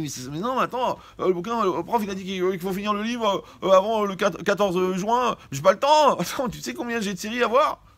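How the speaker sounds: high, loud, flustered student; panicked excuses. Retold or imitated. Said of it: "Mais tu dis".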